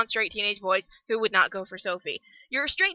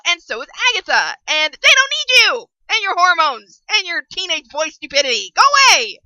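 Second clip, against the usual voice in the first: angry telling-off; 3 semitones higher, 6 dB louder than usual. Acted. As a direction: tell off the shallow boy, building to a yell. Ranting at the character Tidros.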